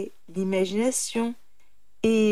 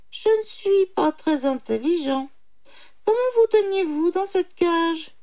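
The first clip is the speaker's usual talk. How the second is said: high put-on animal voice; confused little jackal; 9 semitones higher, 3 dB louder than usual. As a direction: play dim and whiny, pitched high. Jackal feigning stupidity. (petit chacal embrouillé, voix aiguë)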